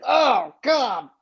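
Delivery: high, loud dismayed groan; pained, drawn-out dismay; appalled. Acted. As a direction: react aghast to what you hear. Listener appalled by student's account.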